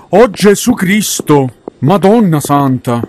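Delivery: slow, loud startled cry; startled exclaiming. Real, not acted. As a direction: cry out in fright. Startled by anomaly in game.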